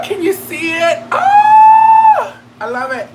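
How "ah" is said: loud delighted scream. Real, not acted. Sees the pin on.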